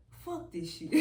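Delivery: ordinary talk, nothing marked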